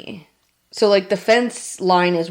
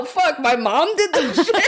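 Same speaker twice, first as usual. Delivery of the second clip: high laughing, swearing through giggles; laughing realization; 8 semitones higher than usual. Real, not acted. Telling a friend about mom's fence, laughing. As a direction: crack up at your own discovery.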